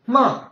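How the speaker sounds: MA falling hard and loud from high; fourth tone. Shown as a demonstration. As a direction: drop MA sharply from the top.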